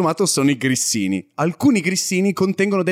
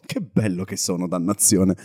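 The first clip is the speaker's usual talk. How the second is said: vain self-admiring voice; 8 semitones lower, 4 dB quieter than usual. Retold or imitated. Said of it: "sto solo dicendo tipo".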